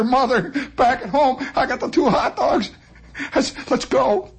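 mock sobbing girl. Girl voiced sobbing her story out.

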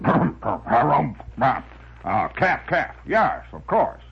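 blustering comic-strip windbag; windbag character. Acted. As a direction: harrumph and sputter between the words like a pompous windbag. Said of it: "he would preface everything with".

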